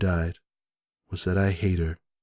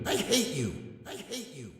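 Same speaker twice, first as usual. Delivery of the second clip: repeated, softer and farther each time; fading echo; 5 semitones higher, 11 dB quieter than usual. Acted. The hateful words echoing away into silence.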